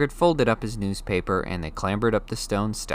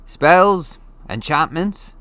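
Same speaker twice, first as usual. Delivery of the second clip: lightly voiced gruff giant, a bit louder; a giant speaking casually to a boy; Hagrid, big friendly giant; 9 semitones higher, 8 dB louder, much slower than usual. A narrator's reading. Hagrid explaining to Harry, casual giant voice.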